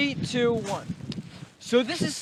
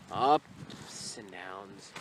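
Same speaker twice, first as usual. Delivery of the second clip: very slow drawl; 13 semitones lower, 7 dB quieter, much slower than usual. Acted. Vlogger drawing out the phrase at the ice, very slow. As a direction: stretch out each word, very slow.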